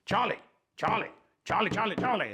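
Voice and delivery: high, exasperated repeated calling; mock annoyance at a dog not listening; imitating annoyed owner. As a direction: call the name with mounting impatience. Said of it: "when we start getting annoyed".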